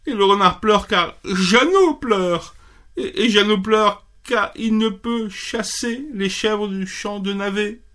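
pitched high, stammering through mock tears; a little rabbit blubbering; high tearful rabbit. Rabbit answering the bee, high and mock tearful. Rabbit explains chain of crying.

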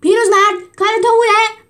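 high, loud accusing yell; shouted accusation at the old gladiator; angry accusation. Acted. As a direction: call him out furiously.

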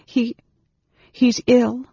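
high, stammered explanation; falter, then force it out high; confused. A narrator's reading. Anne Beryl hiding her face from Caramon, confused and halting. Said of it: "Anne Beryl said in confusion".

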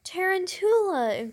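loud high tarantula cry. Acted. Sister remembering the tarantula practice, loud high cry. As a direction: shriek it in alarm. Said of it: "cried, uh, Judy".

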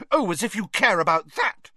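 high, scornful retort; scoffing. A narrator's reading. Hermione doubts Ron cares about Winky, scoffing. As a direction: scoff loudly at the claim. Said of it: "scoffed Hermione".

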